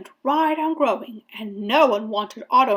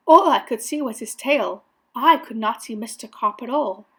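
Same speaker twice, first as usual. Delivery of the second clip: ordinary delivery, nothing marked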